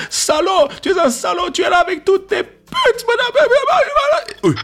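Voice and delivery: high, loud mock screaming insults; hammed-up shrieking girlfriend; mock furious yelling. Ex's furious message, acted out.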